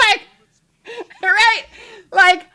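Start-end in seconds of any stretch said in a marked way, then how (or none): none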